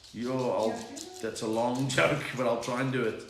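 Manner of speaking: ordinary delivery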